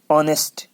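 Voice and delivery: ऑनेस्ट starting on the O vowel; silent H. Shown as a demonstration. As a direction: say ऑनेस्ट as "on-est", no H. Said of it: "एच silent है".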